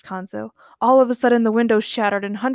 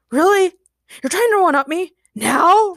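high, loud outraged retort; enraged; 9 semitones higher, 8 dB louder than usual. Acted. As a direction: snap back in disbelief. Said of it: "Ray replied, enraged".

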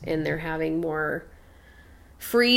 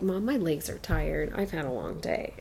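lower, softer mock-weary kid voice; tired kid; 3 semitones lower, 7 dB quieter than usual.

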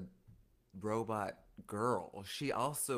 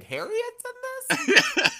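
surprised; 12 dB louder, much slower than usual. Retold or imitated. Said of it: "you were like".

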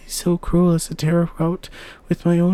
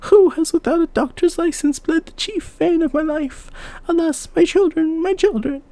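very high, wailing grief; a mother mad with grief over her dead children; high keening mother's lament; frantic lament. A narrator's reading. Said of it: "she cried aloud like one mad".

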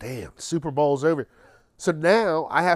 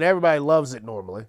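usual speaking voice

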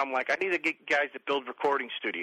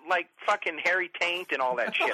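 usual speaking voice

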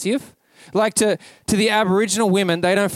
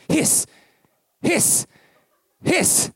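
hissing call; 9 semitones higher, much slower than usual. Retold or imitated. Speaker hissing out the car window at a girl, rising hisses. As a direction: hiss out the window to call her. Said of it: "stuck my head out the window and went".

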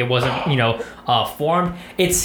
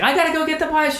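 high urgent imitation of the wife; hurried, high-pitched mock alarm; faster than usual. Wife rushing off for the wipes, mock urgent.

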